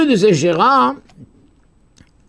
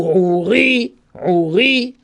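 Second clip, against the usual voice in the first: "Ouri" stretched onto a stressed "ri"; stress on the last syllable; much slower than usual. Shown as a demonstration. Teacher demonstrates milra to class, "Ouri" stressed on "ri". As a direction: land on final "ri" in "Ouri". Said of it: "ça s'appelle Milra".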